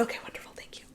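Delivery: tiny whispered thanks, acted out; meek whisper. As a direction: shrink away as you politely accept. Acted meek reply to leaders.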